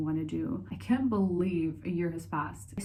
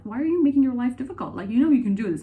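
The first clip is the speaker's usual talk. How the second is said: fast, loud, higher retold challenge; imitated nagging someone; 6 semitones higher, 8 dB louder, faster than usual. Someone questioning the vlogger's life choices, imitated nagging. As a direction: imitate someone pressing with unwanted advice. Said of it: "someone comes to be like".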